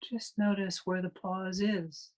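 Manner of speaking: calm, unhurried meditation guide with long pauses; guided meditation. Real, not acted. Meditation teacher leading a class into breath awareness, calm guided meditation. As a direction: guide listeners through the breath in spaced-out, even phrases.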